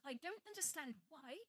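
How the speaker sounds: ordinary talk, nothing marked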